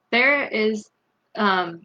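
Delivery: ordinary delivery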